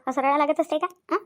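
normal talking voice

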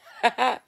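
high loud laugh; short shrieking laugh; burst of laughter. Real, not acted. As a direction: let a sudden laugh burst out. Laughing at the joke.

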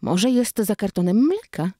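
usual speaking voice

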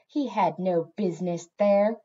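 prim cat voice, at an easy pitch. A narrator's reading. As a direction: say it with prim disapproval.

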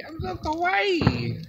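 high, slow cutesy kawaii voice again; excited anime fan. Pretend. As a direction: gush sweetly like an anime girl. Repeats the cutesy line.

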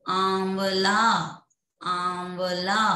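आंवला very slow, opening "aa" through the nose; nasalized vowel demo. Shown as a demonstration. Teacher models next example, slow nasal-vowelled आंवला. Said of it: "जो नाक के द्वारा बोले जाते".

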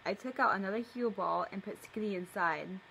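usual speaking voice

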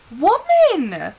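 exasperated cry of a fed-up player; exasperated scolding. Real, not acted. Player scolding her game character, exasperated. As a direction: scold with a rising, drawn-out wail.

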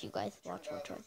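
breathy hushed whisper. Real, not acted. Telling viewers to watch the ad, whispered. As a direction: whisper it so the clip isn't missed. Hushing while the ad plays.

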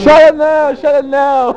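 loud, teasing "don't cry"; mock-comfort a friend, loudly; teasing taunt. Real, not acted. Teasing a friend, laughing after.

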